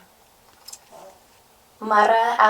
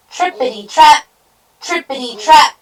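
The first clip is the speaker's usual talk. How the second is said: loud, high rhythmic clatter; bouncy storybook trippity-trap; hoofbeat sound words; 8 semitones higher, 12 dB louder than usual. A narrator's reading. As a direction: punch out the trotting hooves. Hoof sounds of middle goat.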